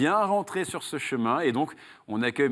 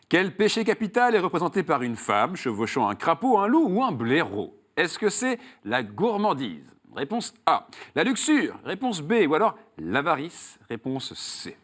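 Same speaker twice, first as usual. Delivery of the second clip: raised, emphatic game-show host voice, settling on the last option; quiz question read; 5 semitones higher than usual. Acted. Host quizzes a contestant on Saul's road, quiz question read. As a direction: pose the question and lay out each choice.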